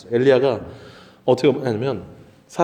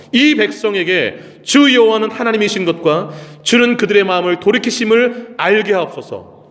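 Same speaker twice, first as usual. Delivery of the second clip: high, drawn-out prayer declamation; fervent scripture reading; 6 semitones higher, 4 dB louder, slower than usual. Acted. Elijah praying to God before the altar, fervent declamation. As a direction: plead earnestly in a raised, measured voice. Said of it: "제가 읽어드릴게요".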